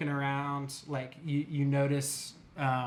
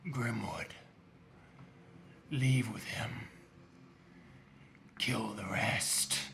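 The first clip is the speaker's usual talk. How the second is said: low, soft, slow order; cold villain command; 4 semitones lower, 7 dB quieter, slower than usual. Acted. Villain orders Grimwood to leave and kill the rest, low and cold. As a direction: hand down the death sentence calmly. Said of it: "he says".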